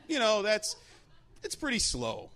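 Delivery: ordinary talk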